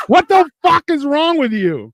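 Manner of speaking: high, slow, indignant shout; shocked angry kid's yell; outraged yell. Retold or imitated. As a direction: yell at the brother who kicked you. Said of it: "and I'm like".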